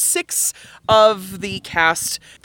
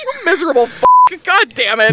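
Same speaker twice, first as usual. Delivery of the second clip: cursing through laughing tears; laugh-crying curse; 9 semitones higher, 7 dB louder, faster than usual. Retold or imitated. Cracking up at her friend, cursing through laughing tears. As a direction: swear while breaking up with laughter. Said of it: "it's like".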